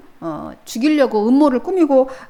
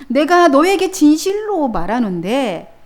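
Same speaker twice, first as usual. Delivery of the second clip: 단호하게; 4 semitones higher, 7 dB louder, slower than usual. Acted. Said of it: "아주 단호하게 말씀하십니다".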